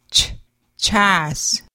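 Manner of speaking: "chas" with a ch as in church; ch sound. Shown as a demonstration. Teacher modelling the letter C for learners, crisp ch. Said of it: "C as in the word church".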